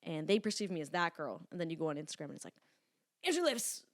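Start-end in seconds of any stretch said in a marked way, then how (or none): 3.2-3.8 mock fan gush. Mocking how followers see her.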